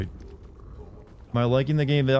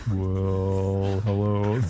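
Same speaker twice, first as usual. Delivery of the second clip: slightly lowered, held-out greeting; fake low voice; 3 semitones lower, much slower than usual. Shown as a demonstration. Streamer tries going below his natural tone, fake-sounding low voice. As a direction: stretch it out in a put-on low tone. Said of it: "try to go lower than my natural speaking tone".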